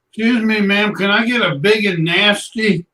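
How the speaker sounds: coworker's crude put-on voice, imitated; mocking voice. Retelling the coworker's mocking voice at the drive-thru.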